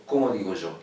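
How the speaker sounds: ordinary talk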